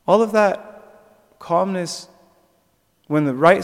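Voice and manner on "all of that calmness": drawn-out stress on "All"; slowed down to drive the point home; slow emphatic. Real, not acted. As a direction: lean hard and slow on "All of that calmness". Rueful slow emphasis after dog story.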